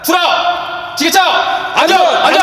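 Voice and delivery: loud team chant; shouted slogan. Real, not acted.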